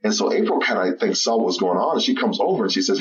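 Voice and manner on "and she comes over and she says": normal talking voice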